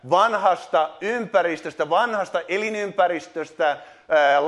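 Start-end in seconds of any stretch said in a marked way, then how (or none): none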